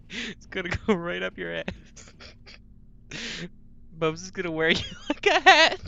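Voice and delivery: laughing through the words. Real, not acted. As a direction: crack up while saying it.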